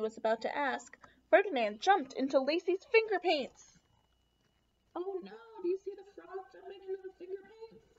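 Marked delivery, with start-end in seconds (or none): 5.0-7.9 excited aside. Acted. Excited aside to young listeners.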